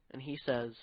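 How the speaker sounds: ordinary talk, nothing marked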